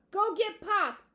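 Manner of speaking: loud, high-pitched yell. A narrator's reading. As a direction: holler it across the house. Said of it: "he yelled, followed by a long pause".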